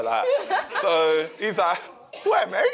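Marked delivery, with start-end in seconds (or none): 2.2-2.7 a light Cockney "Where, mate?", raised; Cockney ticket man. Retold or imitated. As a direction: lightly imitate a Cockney geezer, puzzled. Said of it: "proper Cockney geezer".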